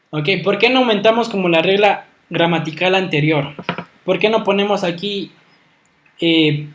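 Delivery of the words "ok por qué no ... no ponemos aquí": usual speaking voice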